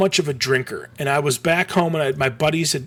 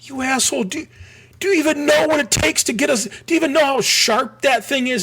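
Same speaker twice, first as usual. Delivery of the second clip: dad's angry outburst; 10 semitones higher than usual. Retold or imitated. Dad yelling at son over the huge sharp sign, much higher mock anger. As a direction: imitate dad scolding his son. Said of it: "my dad was like".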